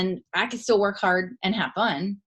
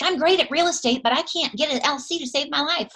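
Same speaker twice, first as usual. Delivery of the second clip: frustrated lady; 7 semitones higher than usual. Retold or imitated. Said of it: "she was getting real frustrated".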